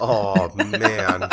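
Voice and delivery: real laughing groan of dismay; cracked up and appalled; laughing disbelief. Real, not acted. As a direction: react through helpless laughter. Laughing at shocking punchline.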